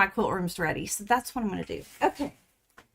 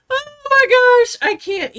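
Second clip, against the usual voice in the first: high delighted squeal; 14 semitones higher, 6 dB louder than usual. Real, not acted. Laughing at funny stickers.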